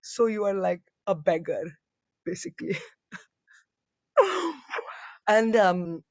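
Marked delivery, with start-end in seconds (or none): 0.0-2.8 a hint of unimpressed mother; deadpan Indian mom. Mother on the phone judging daughter's career, dry. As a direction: flatly dismiss it like mom. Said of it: "And my mom's like".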